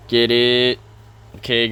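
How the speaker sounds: usual speaking voice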